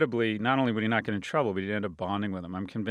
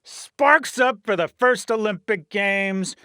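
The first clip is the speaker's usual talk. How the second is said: trailer-voice grandeur over history's big moments; epic announcer; 12 semitones higher, 9 dB louder than usual. Acted. Host opening his podcast with mock-epic announcer voice.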